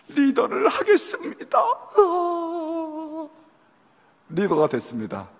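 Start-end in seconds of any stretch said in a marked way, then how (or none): 0.1-3.3 higher, slow, tearful yes; mock crying. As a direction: wail out the yes as you give in. Trembling surrender, then crying. (울먹이며 하겠다고 하는 말투)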